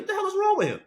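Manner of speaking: high-pitched, baffled reaction; mock incredulous. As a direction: act out exaggerated disbelief. Imagined reaction to an early-morning message.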